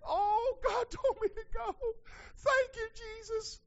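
very high, wailing mock thanksgiving; hammed-up church member's high-pitched wail; mock pious rejoicing. Preacher mimicking a member claiming God's leave, mock pious wail.

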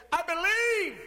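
high, loud, sure declaration; confident believer. Retold or imitated. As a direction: declare it with total certainty. You ever heard somebody say.

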